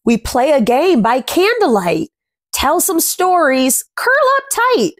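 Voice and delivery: gentle rhyming picture-book read, words savoured; reading to children. A narrator's reading. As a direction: read the rhyme aloud to little ones. Reads rhyming picture book aloud.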